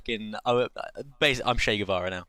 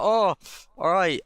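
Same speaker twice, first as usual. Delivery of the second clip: high, louder yelp of sudden surrender; mock startled giving in; 8 semitones higher, 5 dB louder, slower than usual. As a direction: yelp it as if just hit again. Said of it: "I was like".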